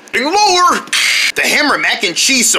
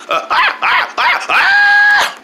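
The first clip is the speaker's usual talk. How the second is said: short, very high yelps; high-pitched cries; much slower than usual. Acted.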